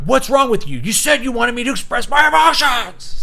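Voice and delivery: high, loud hammed-up shouting; mock angry shout. Boyfriend blowing up at his girlfriend, hammed-up shout. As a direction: rant at her like a raging boyfriend. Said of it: "and then you're like".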